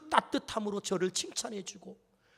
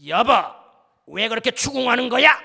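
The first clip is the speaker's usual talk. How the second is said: louder, sharper snapping back; irritated retort; 3 semitones higher, 7 dB louder than usual. Retold or imitated. Husband snapping back at his wife, mildly irritated and louder. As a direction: bite back at her, stung and annoyed. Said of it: "이런 톤으로 제가 한마디 했어요".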